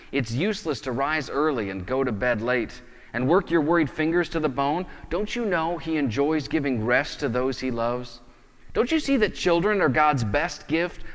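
normal talking voice

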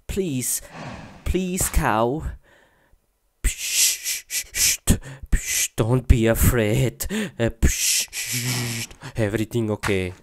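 soft hushed coaxing with psst; calm a skittish animal in a hush; coaxing softly. Pretend. Player coaxing the scared cow closer, soft hushed coaxing.

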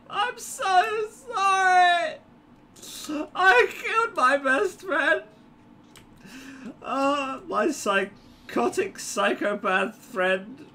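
very high, slow fake sobbing; mock crying. Gamer apologizing to the droid he killed, mock tearful wail. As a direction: wail in hammed-up squeaky grief.

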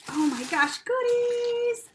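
high, loud squeal of delight; excited squeal. Real, not acted. Excited at opening box.

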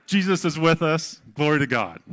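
loud, happy call-back to the congregation; joyful shouted response. Shown as a demonstration. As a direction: proclaim it with full joy. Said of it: "I let you go way too long for you to come back".

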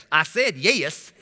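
put-on slow, insistent country-grandma accent; imitated country accent, drawing out "yes". Grandma repeating herself to the automated system, country accent. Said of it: "She had country grandma".

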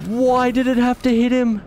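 high-pitched real panic, then dismay; panicked pleading. Real, not acted. Streamer begging the car not to hit him, panicked. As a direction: plead frantically as it goes wrong, pitch way up.

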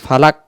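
ফালাক stopped dead on an ordinary k; wrong plain k. Shown as a demonstration. Teacher showing the mistake, ফালাক with plain k. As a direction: end ফালাক with k, no echo. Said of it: "যদি আমি দমটা এইভাবে বলি".

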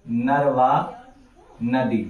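ordinary delivery

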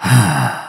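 a long tired sigh out; weary sigh. A narrator's reading. Captain sighing at the brawl blocking his path, weary sigh. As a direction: let the air go, fed up. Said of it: "He let out a sigh".

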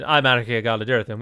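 Arachir Galadirathon with plain English sounds; English pronunciation. Shown as a demonstration. Player repeats his episode intro, Arachir Galadirathon said the English way. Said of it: "a very English way of saying".

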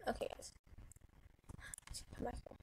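hushed whisper to team. Real, not acted. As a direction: whisper the call back.

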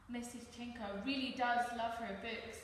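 usual speaking voice

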